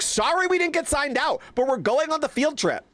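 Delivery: high mock-sorry self-quote; retold own words. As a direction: replay the defiant apology, high. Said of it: "I was like".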